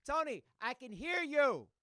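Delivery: yelling. Retold or imitated. Father yells at son again.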